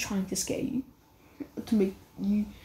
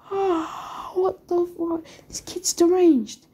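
high-pitched, aghast outburst; shocked disbelief; 8 semitones higher, slower than usual. Acted. Examiners react to Naruto's threats, shocked and high.

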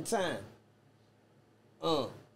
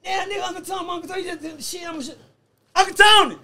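shouts across the room, pitched way up; excited yelling for Uncle Tony; calling out; 13 semitones higher, 10 dB louder than usual. Real, not acted. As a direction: holler his name to get his attention.

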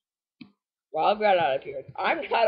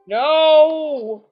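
loud, high cry of frustration; real gamer's anguished no; dismayed yell; 7 semitones higher, 9 dB louder than usual. Real, not acted. Game move goes against player.

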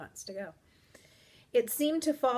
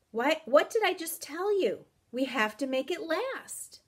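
high, raised impatient reminder; exasperated scolding; 9 semitones higher, 5 dB louder than usual. Acted. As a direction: scold someone who wasn't listening. Exasperated at companion ignoring the warning.

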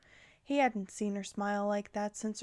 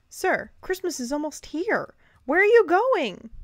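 loud, higher call, rising to a shout; 7 semitones higher, 10 dB louder than usual. A narrator's reading. Curtis chasing Santa to the stables, calling out loud. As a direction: call after him as he leaves.

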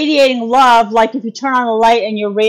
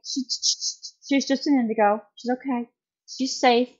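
soft, half-whispered calming words; hushed reassurance; 2 semitones higher, 7 dB quieter than usual. Retold or imitated. Reassuring her frightened friend about the child, soft and hushed. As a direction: settle the worried parent gently.

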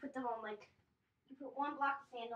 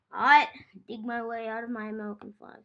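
ordinary talk

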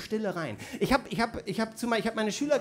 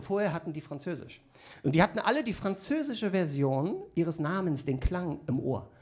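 usual speaking voice

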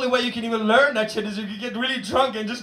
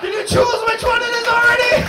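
exasperated shout; 9 semitones higher, 6 dB louder than usual. Real, not acted.

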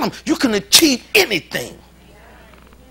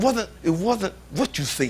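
soft stern mother; 8 dB quieter than usual. Retold or imitated. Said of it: "She said".

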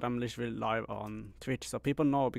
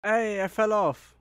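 surprised shout; 6 semitones higher, 6 dB louder, slower than usual. Real, not acted. Sudden in-game mishap.

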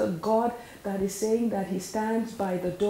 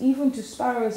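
normal talking voice

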